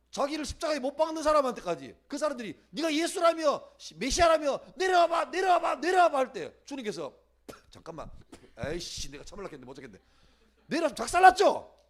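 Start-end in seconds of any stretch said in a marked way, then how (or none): 2.7-6.2 높고 크게 외치는 야유; 해보라고 몰아붙이듯 거듭 외치기; 조롱하는 군중. Acted. 십자가 앞 조롱하는 사람들. 7.7-10.0 낮고 작게 욱하는 말투; 더는 못 참겠다는 듯 나직이; 참다못한 투덜거림. Acted. 못 참는 주님을 가상 연기.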